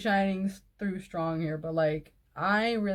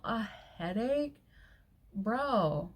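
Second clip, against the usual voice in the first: scornful disbelief; much slower than usual. Real, not acted. Scoffs at his excuse.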